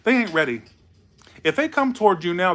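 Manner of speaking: usual speaking voice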